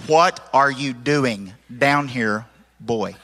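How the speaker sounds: slow, spelled-out delivery; clear translation. Shown as a demonstration. Preacher translating the pastor for his listeners, slow and clear. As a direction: space out every word so it's understood. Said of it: "Did you understand that?".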